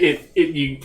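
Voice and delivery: ordinary talk, nothing marked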